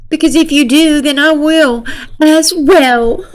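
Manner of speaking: hammed-up giggly threat; fake laugh. Girl telling Nick she'll follow him, fake laughing. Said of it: "the fake laugh and cry thing".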